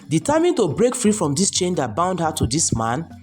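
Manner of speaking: ordinary delivery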